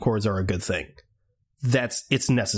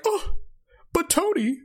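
much higher, whiny mocking Steve voice. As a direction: whine it like a petulant hero being mocked. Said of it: "He's like".

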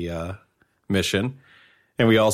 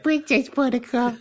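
much higher, mock girly voice; man doing a woman's voice. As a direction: tease her with a squeaky girl voice. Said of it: "I'm a girl. I have a girl voice".

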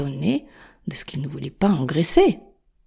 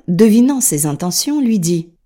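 ordinary delivery, nothing marked

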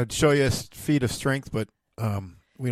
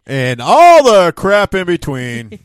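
booming announcer; 6 semitones higher, 14 dB louder, slower than usual. Acted. Podcast intro tagline, booming.

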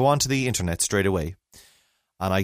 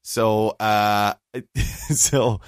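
ordinary delivery

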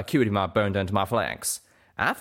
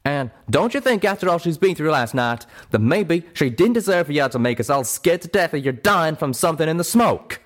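loud, raised-pitch righteous telling-off; angry scolding; 6 semitones higher, 5 dB louder than usual. Acted. Calamity scolds a friend for scaring everyone, loud righteous anger. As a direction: lay into them, loud and indignant.

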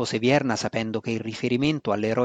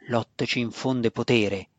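usual speaking voice

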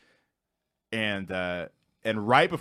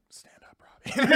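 retold whisper; hushed, voiceless quoted command; whispered aside; slower than usual. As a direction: whisper it like a covert order. Acted quote of a tense moment.

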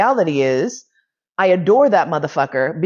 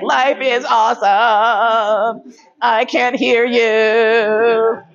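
slow, drawn-out, higher dreamy voice; mock giddy boyfriend; 4 semitones higher, much slower than usual. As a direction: play him blissfully oblivious. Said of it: "So he's just like".

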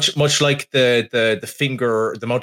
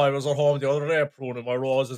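higher-pitched put-on storyteller; hammed-up chummy yarn; mock anecdote voice; 6 semitones higher than usual. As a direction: spin a tall tale to a mate. Acts out actor telling pub story.

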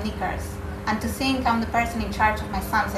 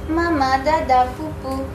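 put-on higher, louder baby babbling; baby babble; 4 semitones higher, 3 dB louder than usual. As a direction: coo it like a toddler.

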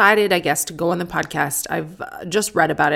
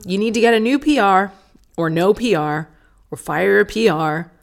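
ordinary talk

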